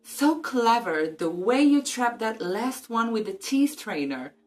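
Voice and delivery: gushing admiration; eager. Acted. Hermione praising Lockhart, eager gushing voice. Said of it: "said Hermione eagerly".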